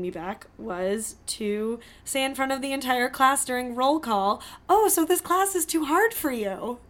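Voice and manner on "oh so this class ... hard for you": mock-snide teacher voice, raised; lightly voiced snooty teacher; teacher's snide remark. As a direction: imitate the teacher, a touch higher. Teacher mocking student in class.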